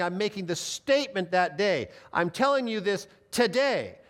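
"today" set apart and punched; emphatic TODAY. Shown as a demonstration. Pastor voicing Jesus's meaning to the thief, emphatic "today". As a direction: build to a firm, stressed "today". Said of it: "the word today is emphatic".

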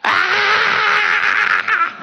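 long, high-pitched mock scream; comic shriek of panic; dramatic scream. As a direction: scream in hammed-up terror. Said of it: "I feel like I'm dramatic".

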